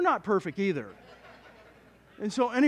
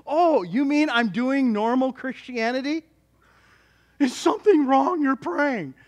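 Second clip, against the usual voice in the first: sarcastic retort; 6 semitones higher, 5 dB louder, slower than usual. Retold or imitated. Son retorting to mom.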